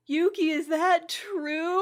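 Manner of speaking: high, swooning mock-tearful question; touched Momo. Momo asking Yuki after overhearing him, mock touched.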